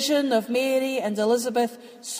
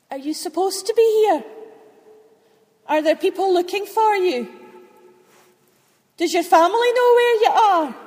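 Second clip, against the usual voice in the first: higher, louder nervous questions, a bit fast; startled girl firing worried questions; frightened young Mary, pitched up; trembling; 7 semitones higher, 6 dB louder than usual. Acted. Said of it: "through her trembling, she answered".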